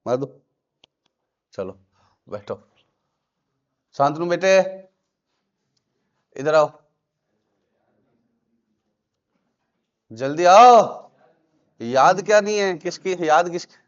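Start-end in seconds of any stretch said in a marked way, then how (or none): 3.9-11.1 rising louder shout to come quickly; calling out loud. Real, not acted. Teacher calling Shantanu up to the board, shouting. 11.8-13.7 scolding. Real, not acted. Teacher scolds reluctant student.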